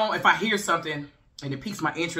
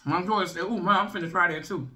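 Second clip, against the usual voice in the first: chewy muffled speech; talking while chewing; 2 semitones higher than usual. Real, not acted. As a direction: mouth partly full of corn. Seen 2: Bit corn at -1; closed-mouth chewing through 3.0 while talking.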